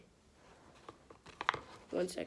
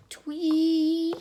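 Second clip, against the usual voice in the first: high, loud mock ref whistle; whistle noise; 6 semitones higher, 8 dB louder than usual. Reading about refs blowing whistles, a loud mock whistle. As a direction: chirp it out like a referee's whistle.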